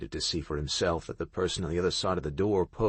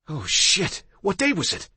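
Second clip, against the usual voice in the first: startled; 10 semitones higher, 4 dB louder than usual. Acted. Startled waking to knocking.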